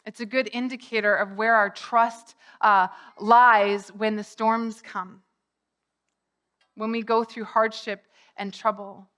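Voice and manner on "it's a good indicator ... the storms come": ordinary talk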